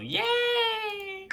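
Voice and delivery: a high, squeaky cheer of joy; squealed yay. Real, not acted. Celebrating the non-British install with a high squeal.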